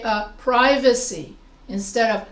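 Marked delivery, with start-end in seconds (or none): 0.4-1.3 "privacy" said PRY-vuh-see, long i. Shown as a demonstration. Teacher showing how we pronounce words, long-i "privacy". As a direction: say "privacy" with a stressed PRY opening. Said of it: "putting the stress on the first syllable".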